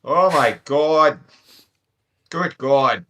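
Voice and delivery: put-on slow drawn-out Canadian accent. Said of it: "the most Canadian sounding".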